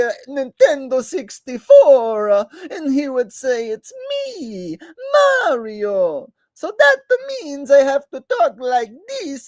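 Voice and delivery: very high, exaggerated Italian plumber cartoon voice; over-the-top high Mario voice; Mario impression. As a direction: ham up a squeaky video-game hero. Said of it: "Can you do Mario and Luigi impression".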